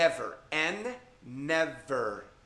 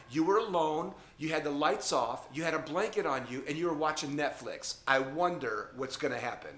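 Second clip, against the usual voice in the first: normal talking voice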